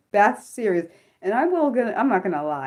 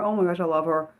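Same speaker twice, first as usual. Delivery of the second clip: ordinary talk, nothing marked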